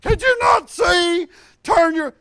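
shouted high and loud at the driver; bossy shout. Retold or imitated. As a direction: bark it like a backseat driver yelling. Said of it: "she's trying to teach everybody how to drive".

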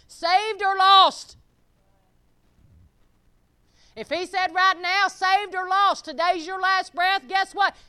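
loud, high-pitched preacher's exhortation; full-force preaching. Real, not acted. Preacher warns the congregation about their last breath, full-force preaching. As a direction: preach it hard, punching out each phrase.

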